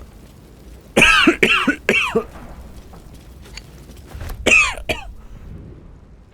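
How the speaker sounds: long, high, unvoiced choking cough. Real, not acted. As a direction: cough hard on a swallow. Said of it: "I'm choking".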